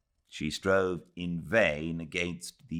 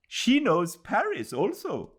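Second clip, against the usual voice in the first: called out; 5 dB louder than usual. Acted. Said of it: "called one of the onlookers".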